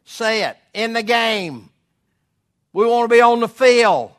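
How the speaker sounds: loud, high, full-force preaching cry; preaching shout. Real, not acted. Preacher rallying the congregation, loud shout. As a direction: lift the crowd with a shout.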